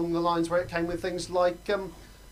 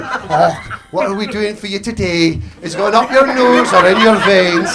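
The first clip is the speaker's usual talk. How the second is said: put-on sweet Scottish granny accent, louder on the last line; a cosy Scottish granny offering sweets; 3 semitones higher, 11 dB louder, slower than usual. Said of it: "a tiny little Scottish granny ... just going".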